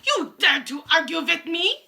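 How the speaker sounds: high, loud witch voice; Grand High Witch screaming. A narrator's reading. Witch confronting a quaking witch, screaming. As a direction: scream the accusation. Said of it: "screamed the Grand High Witch".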